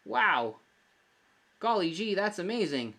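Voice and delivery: put-on high, loud gee-whiz kid voice; hammy old-timey kid wonder; wholesome 1950s kid. Doing the co-host reaction himself.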